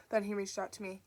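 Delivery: ordinary delivery, nothing marked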